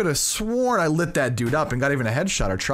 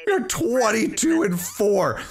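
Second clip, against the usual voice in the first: excited shout; 7 semitones higher than usual. Real, not acted. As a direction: shout it out in triumph. Celebrating a big lead.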